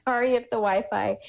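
light embarrassed laugh through the words. Real, not acted. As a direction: laugh it off apologetically, quick and high. Hiding in bathroom, kids asleep.

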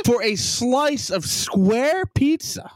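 high, slow indignant disbelief; incredulous outrage. Real, not acted. Ranter griping about square pizza, incredulous outrage. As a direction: draw it out, appalled by the price.

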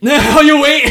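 loud, high, laughing protest; mock outrage. Real, not acted. Host to gloating co-host, laughing outrage. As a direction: burst out at being teased.

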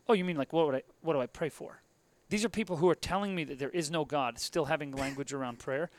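normal talking voice

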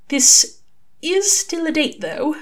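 high, halting and unsure; start, pause, then venture it tentatively; hesitant. A narrator's reading. Aziraphale asks Crowley about the date, hesitantly. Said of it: "Aziraphale said hesitantly".

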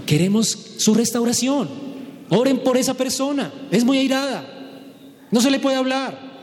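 a pastor announcing to the church that someone is too angry to talk to; mock church announcement. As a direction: play a pastor warning the flock about someone. Preacher voicing a church announcement.

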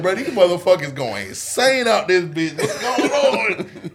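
high, loud, laughing, slowing at the end; baffled. Retold or imitated. Stuck in the classroom, loudly baffled and laughing. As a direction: laugh in disbelief at the noise. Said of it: "I'm like".

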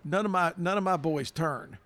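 ordinary talk, nothing marked

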